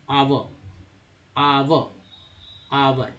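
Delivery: आवर built up piece by piece, आव then आवर; sounding out. Shown as a demonstration. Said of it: "कैसे पढ़ेंगे इसको देखिए". (आवर को टुकड़ों में बोलना)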